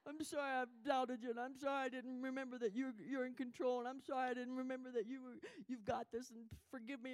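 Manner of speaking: a replayed tearful confession; tearful prayer. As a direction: beg forgiveness through tears. Retold tearful prayer to God.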